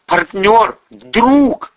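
high, loud strained appeal; near hysteria. A narrator's reading. Hostage begs partner for help, near hysteria. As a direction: plead loudly while holding back hysteria. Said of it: "Он был на грани истерики".

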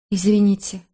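ordinary delivery, nothing marked

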